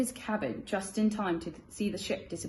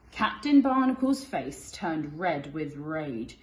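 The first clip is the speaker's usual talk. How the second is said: ordinary delivery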